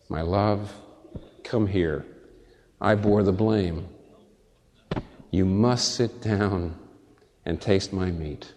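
slow, soft, tender invitation; tender Jesus. Acted. Jesus tenderly inviting the sinner to sit and eat. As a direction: softly welcome the beloved home.

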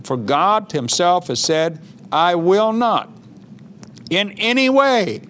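slow, weighty scripture reading; emphatic promise, phrase by phrase. Acted. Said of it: "I'm gonna read that again".

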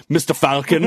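mock TV dub voice; mock dubbed line. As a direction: parody the jarring overdub. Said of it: "he just goes".

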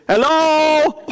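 high, tentative call from below; a spooky hello from the grave. Acted. Voice from inside the grave.

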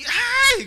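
high, loud "ay" squeal; mock scream of excitement; imitated scream. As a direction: shriek like a telenovela fan. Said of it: "Gritaba y decía".

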